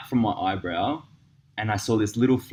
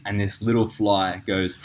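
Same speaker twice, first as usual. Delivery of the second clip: ordinary delivery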